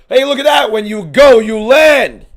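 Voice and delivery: high, loud cheering; excited shout. Real, not acted. Streamer cheering a landed punch, high and loud. Fighter finally lands a punch.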